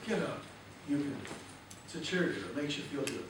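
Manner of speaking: ordinary talk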